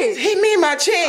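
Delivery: indignant yelp. Real, not acted. Wife yelping at husband about being hit. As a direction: cry out that he hit you.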